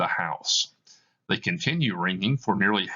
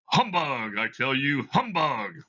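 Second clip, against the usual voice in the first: higher, forced dismissive scoff; nervous scoffing; 6 semitones higher than usual. Acted. Scrooge waving off the ghost, forced nervous scoff. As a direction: scoff to hide the fear.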